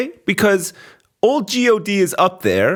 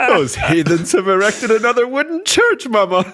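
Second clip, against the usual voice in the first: lightly voiced stuffy husband; a hint of a pompous old man; 5 semitones higher than usual. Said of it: "voicing God to wife".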